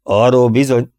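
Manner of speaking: loud booming dentist; big dentist booming the answer. A narrator's reading. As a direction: boom it out heartily. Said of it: "harsogta a hentes".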